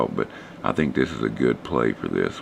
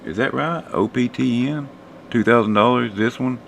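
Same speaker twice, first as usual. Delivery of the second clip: surprised; 9 semitones higher than usual. Real, not acted. Surprised reading a viewer's comment.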